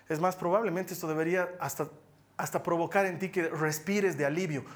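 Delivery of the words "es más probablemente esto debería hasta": usual speaking voice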